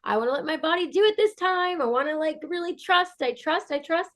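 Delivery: high-pitched, earnest refusal; retelling her insistence to the medical staff, high and pleading. Retold or imitated. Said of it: "And I'm like".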